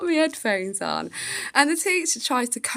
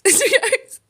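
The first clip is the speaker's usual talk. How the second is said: laughing; 10 semitones higher, 3 dB louder than usual. Real, not acted. Laughs while retelling.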